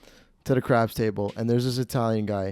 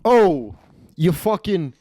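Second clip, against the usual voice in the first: Stefano; 4 semitones higher, much slower than usual. Retold or imitated. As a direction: imitate an indignant Italian-American gambler. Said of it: "insulting her in a very Italian way".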